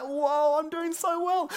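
high, loud delighted gush; excited boast. Retold or imitated. Learner thrilled at earning gems, high and loud. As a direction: brag with giddy delight. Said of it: "It's like".

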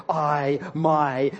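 I and MY each hit loud and alone; loud, isolated stress on I and MY; emphatic I. Shown as a demonstration. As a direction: punch "I" and "my" as separate, forceful words. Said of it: "It's that emphatic I".

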